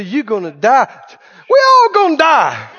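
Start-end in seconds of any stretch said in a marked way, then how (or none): 1.5-2.7 defiant comeback. Acted. Believer answering the threat of death, defiant. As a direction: throw it back as a loud-hearted scoff.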